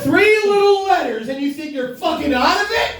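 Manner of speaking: high-pitched indignant rant; mock outrage. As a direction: protest in a high, hammed-up outrage. Mock outrage at texting habit.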